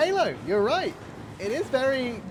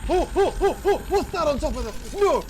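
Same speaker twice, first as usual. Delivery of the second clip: panicked yelling; 6 semitones higher than usual. Real, not acted. Panicked gamer yelling at threat.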